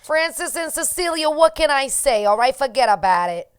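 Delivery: hammed-up New York mobster accent; Goodfellas New York accent. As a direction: play up the New York accent like a mob movie. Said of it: "I came just off of Goodfellas".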